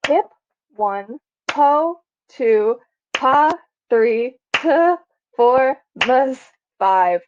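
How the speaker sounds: Hip, po, pa, t, mus as separate beats, each followed by its number; counted syllables. Shown as a demonstration. Teacher counting a tricky word, each syllable a separate beat. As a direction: alternate each chunk of hippopotamus with its count, in even beats. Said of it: "That was five".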